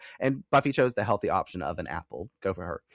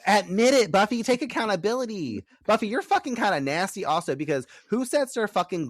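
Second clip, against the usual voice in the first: scolding Buffy; 10 semitones higher, 4 dB louder than usual. Real, not acted.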